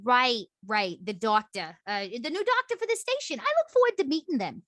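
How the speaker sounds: animated character voice climbing high; eager game character. Acted. Character anticipating the new station doctor, eager rising voice. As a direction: start level, then lift high with eagerness.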